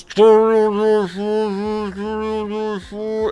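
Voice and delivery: high, muffled mock garble of "Charlie on line four"; garbled intercom. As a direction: mumble "Charlie on line four" like a broken speaker. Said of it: "Or worse".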